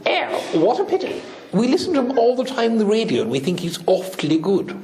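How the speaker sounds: high-pitched royal lament; Queen of England. Acted. Replying to the president about the rabbi, high mock-royal voice. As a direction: put on a high, regal English voice. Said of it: "The Queen of England".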